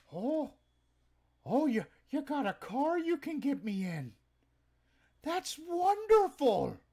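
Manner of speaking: put-on high, loud excited old-timer voice; customer's voice. Customer thrilled at the car offer, high excited voice. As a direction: squeal with delight like the eager buyer. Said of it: "let's see if I can still do his voice".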